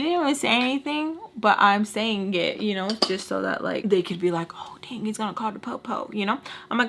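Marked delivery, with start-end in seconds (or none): 4.5-6.1 hushed mock whisper. Imagining the girls reacting, a hushed whisper. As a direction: whisper it like they're scared.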